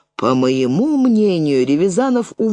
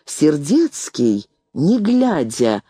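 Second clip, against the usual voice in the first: plain reading voice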